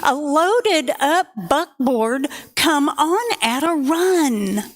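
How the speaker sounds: put-on lilting rhyme read to children; story narration. A narrator's reading. Santa poem read aloud to children, lilting storyteller voice. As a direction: read the poem to the kids, with a bounce.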